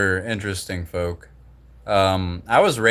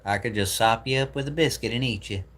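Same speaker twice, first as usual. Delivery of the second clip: higher, put-on Southern twang; Southern American accent; 4 semitones higher than usual. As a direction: imitate a Southerner's folksy saying. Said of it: "That's very Southern American".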